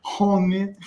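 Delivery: mock Russian-accented "homie", short and teasing; imitated Russian accent. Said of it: "They can't hide that Russian accent".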